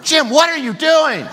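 high, loud mock outcry from a wife; shocked scolding. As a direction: imitate a scandalized wife crying out. Wife's shocked scolding, retold.

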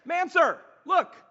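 high, loud coach call in the huddle; coach shouting. Retold or imitated. Coach in the huddle yelling to his player, loud and high. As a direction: yell to grab the player's eyes. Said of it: "the coach is like".

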